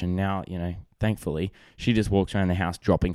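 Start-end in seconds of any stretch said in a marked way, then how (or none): none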